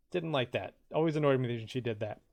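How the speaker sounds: normal talking voice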